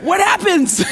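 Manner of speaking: high, loud playful question; mock suspense with a laugh; teasing suspense. Real, not acted. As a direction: tease the crowd about what comes next. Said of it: "Hold that suspense for a minute".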